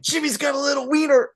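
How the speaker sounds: put-on high, giggly childish taunt; mock schoolboy taunt. Podcaster voices a whispered childish taunt, high and giggly. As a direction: tease like a naughty kid. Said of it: "you know he's just saying".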